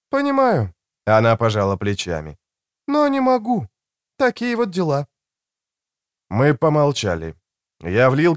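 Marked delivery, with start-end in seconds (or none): 0.1-0.7 high-pitched voice for a woman; higher female character voice; woman's voice. A narrator's reading. 2.9-5.1 high-pitched voice for a woman; higher female character voice; woman's voice. A narrator's reading.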